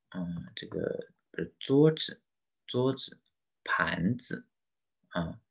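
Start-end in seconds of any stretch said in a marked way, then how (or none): 1.6-4.5 桌子 and 盘子 with a light, short final 子; neutral-tone demo. Shown as a demonstration. Teacher models words for students, 子 in 桌子 and 盘子 in light neutral tone. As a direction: stress the first syllable, let 子 drop away lightly in 桌子, 盘子. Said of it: "轻声".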